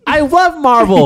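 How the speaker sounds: put-on high, slow, shouted kid voice; excited kid shout. The imagined son shouts his love of Marvel to his father. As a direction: yell it like an overjoyed child. Voicing the kid's answer.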